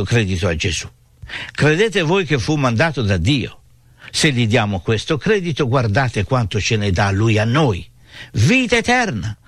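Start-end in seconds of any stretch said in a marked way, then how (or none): none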